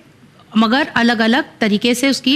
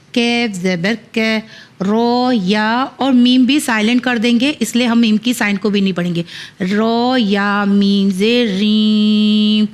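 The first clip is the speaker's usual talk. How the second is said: ordinary talk, nothing marked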